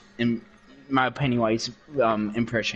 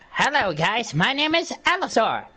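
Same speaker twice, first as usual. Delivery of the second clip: Alastor; 6 semitones higher than usual. Shown as a demonstration. Showing off an impression to listeners, high theatrical radio demon. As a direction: greet everyone as a theatrical radio demon, stretching the name. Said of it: "which I'm gonna do right now".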